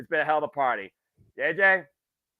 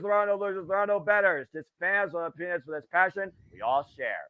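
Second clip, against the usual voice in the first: ordinary delivery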